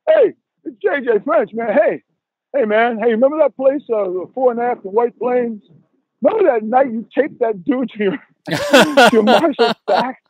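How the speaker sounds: excitedly reminiscing, breaking into a laugh at the end; excited fan. Retold or imitated. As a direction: imitate a fan hailing an old idol. Fan greeting him at station.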